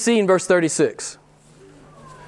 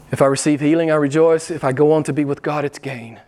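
usual speaking voice

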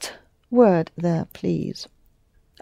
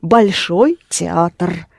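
Большой театр spoken slowly, stress on Большой; slow and clear; 2 semitones lower, much slower than usual. Shown as a demonstration. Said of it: "Да".

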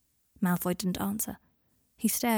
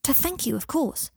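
put-on higher, mildly exasperated child; a touch of "isn't it obvious"; stating the obvious; 6 semitones higher than usual. A narrator's reading. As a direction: explain it as if he's being thick. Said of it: "as if Harry were being deliberately thick".

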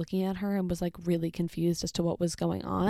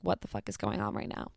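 much lower and softer; low coworker voice; 10 semitones lower, 5 dB quieter than usual. Retold or imitated. Coworker turned to the wall, low and quiet. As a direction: drop low like a stunned coworker muttering.

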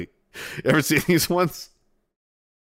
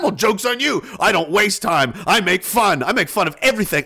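loud, raised-pitch tirade; hammed-up indignant telling-off; mock angry rant; 5 semitones higher, 4 dB louder than usual. Caller telling off scam call center, mock angry rant. Acted rant back at the scammer.

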